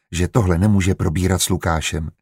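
ordinary delivery, nothing marked

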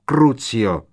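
shouted curse. A narrator's reading. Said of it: "vykřikla".

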